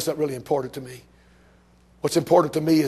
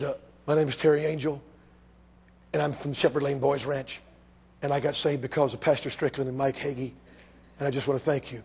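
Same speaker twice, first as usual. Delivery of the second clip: timid; 3 semitones lower, 6 dB quieter than usual. Retold or imitated. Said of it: "So very timidly I said".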